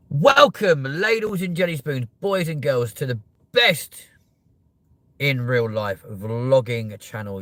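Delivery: big, high, loud announcer opening; show host intro. Acted. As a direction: welcome the viewers like a game show host.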